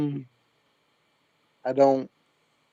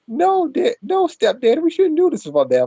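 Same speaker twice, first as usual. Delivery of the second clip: put-on much higher, squeaky girlish plea; mock stepdaughter voice; 12 semitones higher, 3 dB louder than usual. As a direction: play the protesting stepdaughter, high. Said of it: "I'm like".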